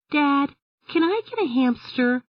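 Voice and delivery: put-on high, young girl's voice; a little girl asking eagerly; Emily's voice. A narrator's reading. Girl begs her dad at the pet store, high young voice. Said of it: "Emily asked".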